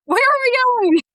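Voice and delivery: very high, giddy squeal; hammed-up can't-wait enthusiasm; eager excitement. Voices her eager morning self.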